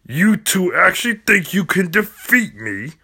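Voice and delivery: a sneering cartoon villain; taunting villain. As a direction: scoff at the heroes.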